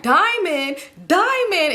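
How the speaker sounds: high, incredulous echo, climbing on the repeat; mocking repetition. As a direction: mimic an aunt scoffing at the claim. Aunt mocks the diamond claim.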